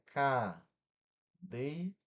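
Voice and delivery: cadeira broken into CA, DEI, RA with long gaps; split into syllables. Shown as a demonstration. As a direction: say cadeira one slow syllable at a time. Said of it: "separa a sílaba". ("cadeira" separado sílaba por sílaba)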